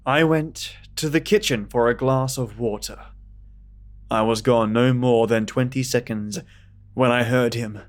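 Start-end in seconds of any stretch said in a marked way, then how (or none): none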